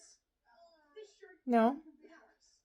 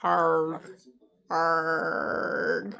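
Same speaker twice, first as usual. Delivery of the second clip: pirate growl; 6 semitones lower than usual. Pretend. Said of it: "You sound like a pirate".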